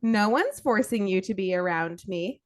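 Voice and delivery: normal talking voice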